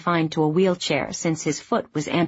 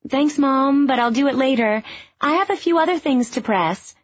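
higher, raised to carry down the stairs; calling back; 7 semitones higher than usual. A narrator's reading. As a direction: call down to mom. Ruby calls back downstairs.

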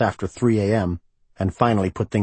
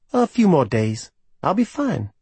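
lightly raised woman's voice; Livy's voice; 9 semitones higher than usual. A narrator's reading. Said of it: "Livy's reply in the story".

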